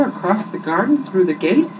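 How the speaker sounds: ordinary delivery, nothing marked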